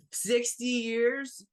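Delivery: high, slow, drawn-out disbelief; shocked disbelief. Acted. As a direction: stretch it out, stunned and way up. Angie shocked at the number.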